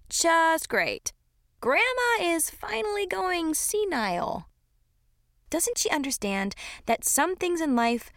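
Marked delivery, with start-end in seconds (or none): 0.1-4.4 high-pitched sarcastic dismay; mildly exasperated. A narrator's reading. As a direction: pitch it up, fed up with grandma. Exasperated reaction to grandma's humming.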